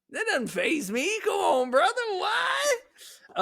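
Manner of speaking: squeaky high, comic disbelief; mock incredulity. Mock brushing off bad comments.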